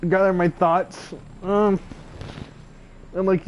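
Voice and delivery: normal talking voice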